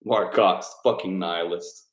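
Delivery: normal talking voice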